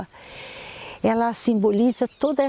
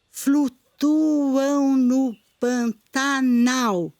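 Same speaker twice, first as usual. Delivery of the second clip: "Flutuam no Pantanal" broken into slow, separate syllables; syllable-by-syllable scansion, slow and measured; counting syllables; 3 semitones higher, much slower than usual. Shown as a demonstration. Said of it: "A gente conta essa última porque é uma sílaba tônica".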